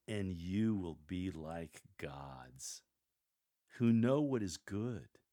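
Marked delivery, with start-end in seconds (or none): 0.1-2.8 very slow, lower and softer "and you will be like gods"; very slowly. Shown as a demonstration. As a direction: draw it out like a secret. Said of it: "Speak very slowly when you read".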